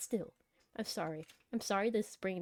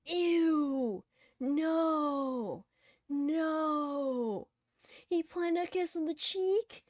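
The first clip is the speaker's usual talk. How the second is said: disgusted outburst; 8 semitones higher, much slower than usual. Real, not acted. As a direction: recoil in exaggerated horror, dragging out the words. Disgusted reaction to kiss in game.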